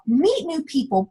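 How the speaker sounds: ordinary talk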